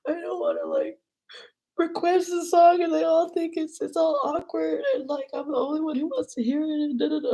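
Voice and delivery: high, whiny, tearful complaint; really upset. Retold or imitated. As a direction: imitate your upset self whimpering. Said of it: "really upset 'cause I was like".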